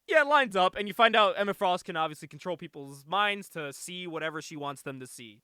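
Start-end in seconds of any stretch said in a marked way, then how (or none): 0.1-0.8 high, loud mock cop voice; imitated cop. Cop confirming the fake officers, loud high imitation. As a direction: play the fooled cop big and high. Retold cop accepts the disguise.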